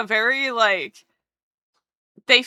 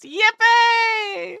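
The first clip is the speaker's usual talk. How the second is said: excited cheer; 11 semitones higher than usual. Acted. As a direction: shout it with glee.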